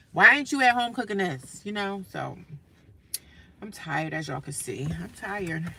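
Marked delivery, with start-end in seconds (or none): 0.1-1.6 judging coworker. Retold or imitated. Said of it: "they gonna be like".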